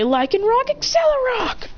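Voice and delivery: very high attack cry; battle command. Acted. Olivia ordering Lycanroc to attack, very high battle cry. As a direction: soar up on the move's name.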